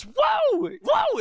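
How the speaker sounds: high shouted whoa like Yosemite Sam; excited whoa. Retold or imitated. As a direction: yell it out like a cartoon cowboy. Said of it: "he goes".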